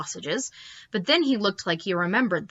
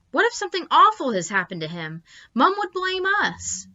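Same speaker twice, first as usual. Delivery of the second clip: worried; 10 semitones higher than usual. A narrator's reading. Sister panics.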